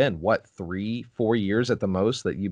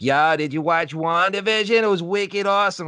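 higher, louder Boston accent impression; 8 semitones higher, 4 dB louder than usual. Imitating a Boston coworker raving about WandaVision, Boston accent. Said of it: "he has a Boston accent".

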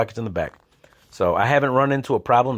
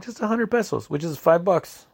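much higher, breezy cop voice; cop's price; 8 semitones higher than usual. Retold or imitated. Cop casually names the bribe price, higher voice. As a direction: pitch it up like it's nothing.

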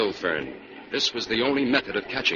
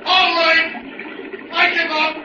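loud, higher call for the lights; shouted command; 4 dB louder, slower than usual. Acted. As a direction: bark it across the falls. Calling for lights on killer.

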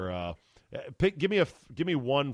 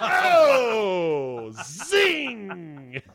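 zing; 6 semitones higher, 7 dB louder than usual. Real, not acted. Host caps his own joke.

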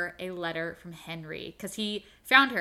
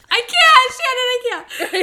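very high, loud laughing wail; overwhelmed cry-laugh, pleading to stop; about to cry; 14 dB louder than usual. Real, not acted. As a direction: squeal helplessly through near-tears. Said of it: "It's gonna make me cry".

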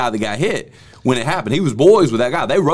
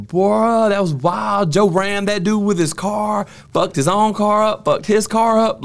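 high-pitched, drawn-out disbelief; excited retelling; 8 semitones higher, slower than usual. Retold or imitated. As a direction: recount the wild crash in a raised, amazed voice. Retelling friends' stunned chatter.

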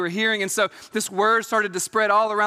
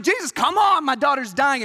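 panicked plea; 6 semitones higher, 4 dB louder than usual. Retold or imitated. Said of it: "Jairus is probably freaking out".

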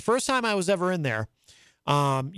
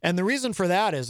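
ordinary talk, nothing marked

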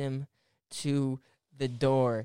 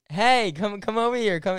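calling over; 6 semitones higher, 5 dB louder than usual. Retold or imitated. Calling friend over in retold story.

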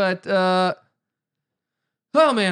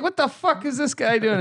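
higher, put-on Irish accent; 9 semitones higher than usual. Irish local puzzled by an American's TV request, mock Irish accent. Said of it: "I like my Irish accent".

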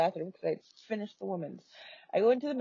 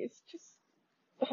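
crying; 4 semitones higher, 6 dB quieter than usual. Real, not acted. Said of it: "Sorry, I'm crying".

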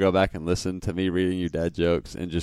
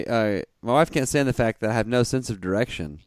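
ordinary talk, nothing marked

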